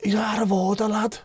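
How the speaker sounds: high put-on voice of an angry Scouser; mock Scouse fan voice. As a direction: squeak it out like an outraged rival fan. Voicing a rival fan.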